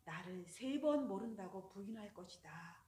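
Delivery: Jesus softly warning Peter; calm Jesus. Acted. Jesus foretells the denial.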